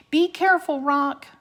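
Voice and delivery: high, loud call after the bird; shouting. Acted. Annie calling after the raven flying off, shouting. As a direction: call up to the sky. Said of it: "Annie shouted".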